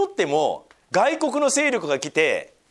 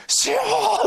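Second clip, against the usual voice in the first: high, drawn-out imitated cry of 主よー; crying out to the Lord; much slower than usual. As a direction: call 主よー up to God in a pleading wail. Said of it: "ってこうやって祈ってる".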